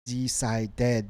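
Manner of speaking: "Decided" sounded out with a full final ded; ed as syllable. Shown as a demonstration. Teacher explaining the rule, "Decided" with ed as syllable. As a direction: give "Decided" three separate beats. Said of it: "becomes a syllable".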